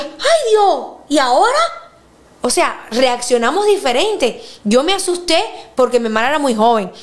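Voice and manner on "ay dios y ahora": alarmed. Retold or imitated. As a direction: panic at the news. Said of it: "y yo decía". (alarma exagerada)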